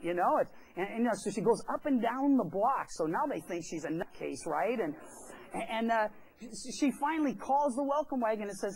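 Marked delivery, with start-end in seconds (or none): none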